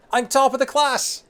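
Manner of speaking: very high, loud, drawn-out boast; triumphant cry. Acted. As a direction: crow it out in triumph. Celebrating in-game exam result.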